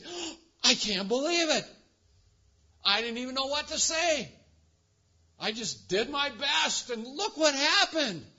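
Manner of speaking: high, loud, astonished exclamation; marvelling believer, voice lifted in wonder; amazed disbelief. Retold or imitated. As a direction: play the stunned friend who can't believe it. Imitating amazed believer after counseling.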